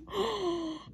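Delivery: high delighted gasp. Real, not acted. Admiring her own nail, gasping in delight. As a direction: gasp as if you can't believe it.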